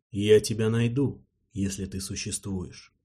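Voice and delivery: inner voice. Acted. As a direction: promise it silently to yourself. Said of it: "пообещал Геринг мысленно".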